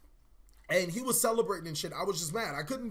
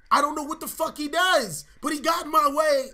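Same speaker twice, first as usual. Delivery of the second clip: angry; 8 semitones higher, 8 dB louder than usual. Real, not acted. Angry retelling of teammate's mistake.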